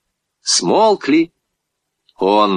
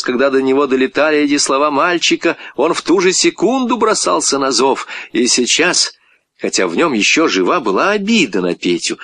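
usual speaking voice

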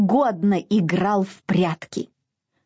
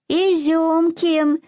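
high, slow sleepy lisp; sleepy babble; 7 semitones higher, slower than usual. A narrator's reading. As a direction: babble half asleep. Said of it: "лепетал спросонья Фадейка".

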